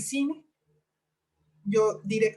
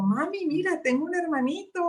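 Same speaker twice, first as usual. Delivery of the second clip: higher, lightly voiced excited child; excited little girl; 8 semitones higher than usual. Daughter showing mom the phone, excited high child voice. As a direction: sing it out like a thrilled little girl. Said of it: "me dice emocionada".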